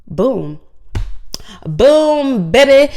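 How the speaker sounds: jittery from too much coffee; wired on coffee, can't sit still. Real, not acted. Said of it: "extra jittery today because your girl been drinking a lot of coffee".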